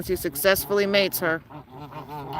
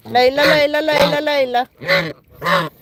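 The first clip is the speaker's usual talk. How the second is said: high, louder call of Layla; 7 semitones higher, 4 dB louder, slower than usual. Real, not acted. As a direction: call the goose over, higher and louder. Calling the goose by name.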